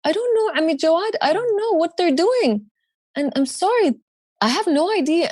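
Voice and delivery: high and choked, a hint of tears; retold crying. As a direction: relive the helpless crying to the uncle. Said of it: "And we're crying".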